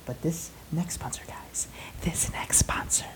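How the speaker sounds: hushed teasing whisper. Acted. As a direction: lean in and whisper it. Teasing next sponsor.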